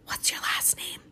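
secret whisper. A narrator's reading. Amanda asking Maniac's name in front of her mom, whispering. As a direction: ask it under your breath. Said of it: "She whispered".

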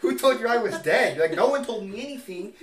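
high, loud put-on voices; mock indignant back-and-forth. Acting out the phone call for sibling, high and loud. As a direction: play both sides hammed up. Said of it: "And I'm like".

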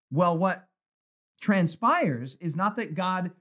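ordinary talk

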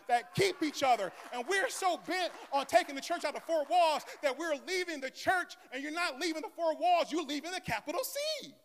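high, ringing preacher's climax; full-force preaching. Acted. Pastor warns the congregation about leaving church, preaching at full force. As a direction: preach it hard to the room.

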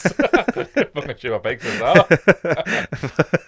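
words squeezed out through laughter, slow; talking while cracking up; laughing. Real, not acted. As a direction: laugh the line out slowly. Laughing at pot lids joke.

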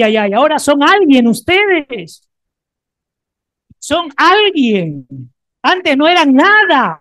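loud, high, fervent preaching; full-force preaching. Acted. Pastor rousing the congregation after the declaration, fervent preaching. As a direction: preach it with all your fire.

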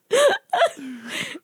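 a squeal of laughter; high giggle fit. Real, not acted. High laugh after joke.

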